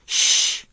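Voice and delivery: mocking unvoiced shush; echoed shush. A narrator's reading. Charlie mockingly echoing Strauss's shush, breathy hiss. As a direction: throw the hush back at him. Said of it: "I echoed".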